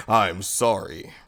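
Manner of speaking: slow, low, soft apology; mock-smooth, hushed sincerity; apologetic manager. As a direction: drop deep and draw it out. Manager wooing mom with apology.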